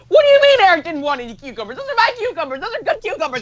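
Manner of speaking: very high, fast mock mom tirade; hammed-up indignant mother, pitch shooting way up; outraged mom. Mom hears Eric refused her cucumbers, hammed-up high outrage.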